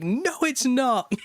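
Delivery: high, drawn-out mock toddler wail; upset small child. Dad imitating his son over lost crisps, upset child. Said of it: "he just loses it".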